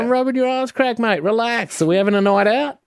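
a hint of a hammed-up larrikin; cheeky mate voice. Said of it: "I go".

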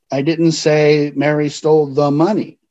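"money" punched at the end of the line; stress on MONEY. Shown as a demonstration. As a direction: lean hard on the last word "money". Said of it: "because of the way I emphasized a word".

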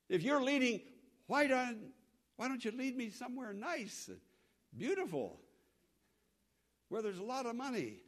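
whining complaint. Retold or imitated. Mock complaint of believer.

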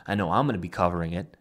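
ordinary delivery

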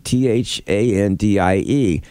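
usual speaking voice

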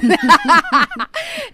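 high, loud real laugh; burst of laughter. Real, not acted. Laughing at jail joke.

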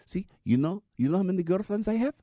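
high mock Pakistani accent. Driver quizzing his passenger, in a mock Pakistani accent. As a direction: make the point in a Pakistani accent.

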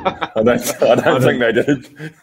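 laughing while talking; chuckling through the words. Real, not acted. Laughing at a silly question.

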